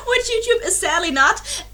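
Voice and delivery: laughing it off; a rueful laugh through the words. Real, not acted. Laughing at herself to her viewers. Laughing at own sad admission.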